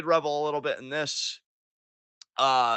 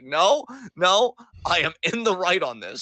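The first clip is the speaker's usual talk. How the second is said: mock-defiant, louder insistence; stubborn doubling down; 4 semitones higher, 4 dB louder than usual. Host voicing Xavier refusing to back down before everyone, stubbornly defiant. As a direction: dig in, imitating someone who won't back off. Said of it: "he doubles down".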